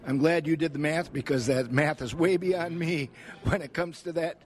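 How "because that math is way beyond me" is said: normal talking voice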